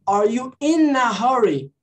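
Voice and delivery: "in a" fused into "inna", spoken slowly; linked words. Shown as a demonstration. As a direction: join "in a" without a break, slowly. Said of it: "Viu como é que você falou?". ("in a" juntado, frase devagar)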